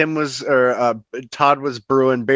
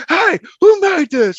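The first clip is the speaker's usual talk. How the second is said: loud, high, drawn-out customer yell; mock irate shout; 5 dB louder, slower than usual. Acting a skit customer confronting fast food staff, mock irate shout. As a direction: yell it like an outraged diner in a skit.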